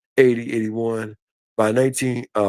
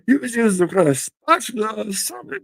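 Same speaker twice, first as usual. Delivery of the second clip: much higher, laughing through disbelief; incredulous laughing; 9 semitones higher than usual. Real, not acted. Retelling the beaver excuse to Lisa, laughing high. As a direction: crack up at the spatula excuse. Repeats spatula line, laughing.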